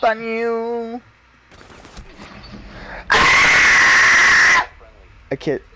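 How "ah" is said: loud high startled yell; real startled yelp; startled scream. Real, not acted. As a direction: yelp at the enemy appearing. Scream at game enemy.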